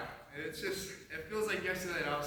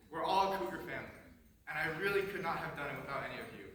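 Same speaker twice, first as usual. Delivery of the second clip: ordinary delivery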